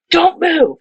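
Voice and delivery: high-pitched, urgent command; sharp mock order. Surgical team to helper with hand in patient, sharp order. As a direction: act out a tense warning, pitched well up.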